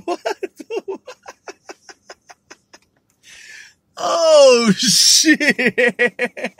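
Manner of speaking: high, loud laughing through the words; cracking up at a silly word; laughing fit. Real, not acted. Host cracking up at the word chucklehead, laughing hard.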